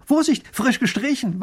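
high, loud sudden warning; warning call. Acted. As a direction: warn her quickly. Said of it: "warnt er sie".

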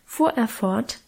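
ordinary delivery, nothing marked

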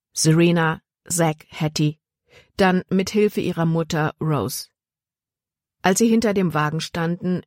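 usual speaking voice

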